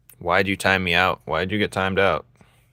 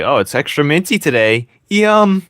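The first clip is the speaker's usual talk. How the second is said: high, louder fake-delighted voice; mocking mint fan; 7 semitones higher, 5 dB louder than usual. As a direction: ham up a gushing mint lover, peaking on yum. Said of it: "You're just like".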